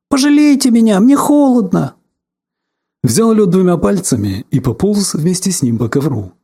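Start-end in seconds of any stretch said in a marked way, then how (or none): 0.1-1.9 much higher, louder squeak; squeaky little voice. Pretend. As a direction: squeal like a tiny creature. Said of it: "запищал тоненьким голоском". (тоненький писклявый голосок)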